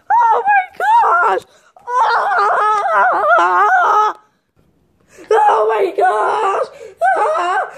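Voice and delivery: high, loud screams with crying; hammed-up horror screams and sobs; terrified screaming. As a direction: scream as if being attacked. Acted attack by stuffed animals.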